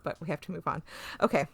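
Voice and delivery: usual speaking voice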